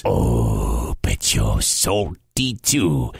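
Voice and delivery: very low giant snarl. Acted. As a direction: answer in a deep, menacing rumble. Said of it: "snarled the giant".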